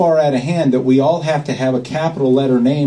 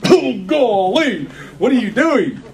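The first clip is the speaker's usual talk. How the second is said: high, slow, alarmed "oh golly"; a hammed-up, high-pitched shocked exclamation; shocked gasp; 10 semitones higher, slower than usual. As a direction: act out your horror as he signs blind. Retelling shocked reaction to signing.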